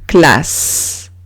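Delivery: "classe" ending in a long hiss; double S. Shown as a demonstration. Teacher showing what doubling gives, hissed s. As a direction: say "classe" as klass, voiceless ending. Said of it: "Tengo que doblar la S".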